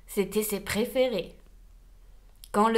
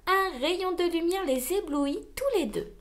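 ordinary delivery

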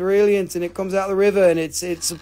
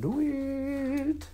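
high, soft, drawn-out coaxing; teasing high-pitched nudge; playful egging on; 9 semitones higher, 10 dB quieter than usual. Pretend. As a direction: stretch it out high and small.

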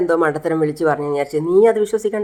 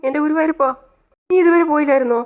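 much higher, exasperated snap of a cry; angry outburst; 8 semitones higher, faster than usual. Acted. Startled brother snaps at the girl tugging his shorts, angry and high. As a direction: throw up your hands and snap at her. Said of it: "ദേഷ്യപ്പെടല്ലേ".

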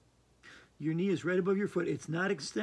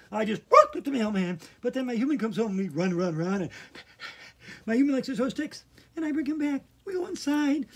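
high, loud, excited dog voice; much higher and louder, howling at the end; dog's voice; 8 semitones higher, 9 dB louder than usual. Acted. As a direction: bark it out like an eager dog. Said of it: "a dog goes".

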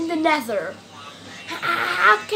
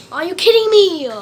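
exasperated; 4 semitones higher, 8 dB louder than usual. Real, not acted. Frustrated at game mob.

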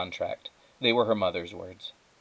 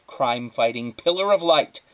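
usual speaking voice

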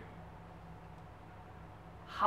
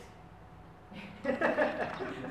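laugh; 13 semitones higher than usual. Real, not acted. Laughs at own Mister Yes remark.

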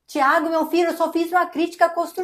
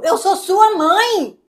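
higher, drawn-out outrage; indignant protest; 4 semitones higher, much slower than usual. Acted. Mother rebuking son for talking back, drawn-out indignation. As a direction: stretch it out, offended and insistent.

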